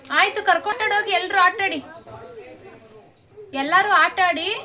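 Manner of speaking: loud, high call to a group. Real, not acted. Mother calls out to all children.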